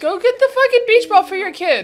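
high, loud order; exasperated yell. Real, not acted. Reactor to parent about the lost beach ball, exasperated yell. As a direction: yell the demand at the screen.

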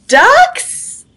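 high, loud hopeful call; questioning call. Acted. Duck still looking for his duck friends, calling out.

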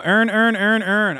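high, loud mock Baltimore accent. Streamer quoting the accent's famous test phrase, mock Baltimore accent. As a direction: make every word the same 'earn', Baltimore style. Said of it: "one of the most fucked up accents".

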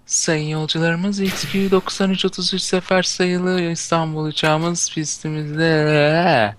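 high, slow airport announcement voice; airport announcer woman. Acted. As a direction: imitate the airport lady on the speakers. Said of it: "böyle bir tane bayan konuşur".

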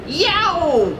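high storybook yelp; crying out. A narrator's reading. Gladstone sees the ghost coming, crying out. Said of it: "cried Gladstone".

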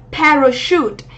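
"Parachute" stressed on Pa, with ch said as sh; teacher modelling PA-ra-shoot; first-syllable stress, SH. Shown as a demonstration. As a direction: say "Parachute" with initial stress and a sh sound. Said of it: "trọng âm một này và âm này là âm sờ".

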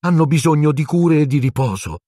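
high-pitched, flustered protest; spluttering. A narrator's reading. Nurse objecting to the headmaster, flustered. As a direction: protest on the patients' behalf. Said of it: "farfugliò Madame Pomfrey".

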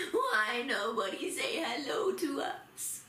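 slow, hammed-up whine; mock wail. Teacher playing snubbed traveller for children, mock wailing. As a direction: drag it out like a pretend sulk. Acting upset at no hello.

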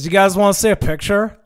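loud, high mock enthusiasm; hammed-up, pushy offer; imitated eager offer. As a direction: play an oddball woman butting in loudly. Imitates woman's eager offer to group.

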